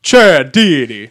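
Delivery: slow, drawn-out wrestler name in a deep announcer tone; low-voiced Chad Deity. Shown as a demonstration. Podcast host demoing the wrestler's entrance, deep and slow. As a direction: boom the name out slowly like a wrestling intro. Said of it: "says it in, like, a really low voice".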